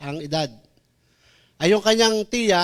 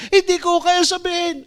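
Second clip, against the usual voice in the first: put-on high, timid girl's voice; girl's small voice; 11 semitones higher than usual. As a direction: shrink into a scared teen. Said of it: "girl's reply". (mataas at mahiyaing boses ng dalagita)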